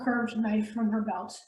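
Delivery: usual speaking voice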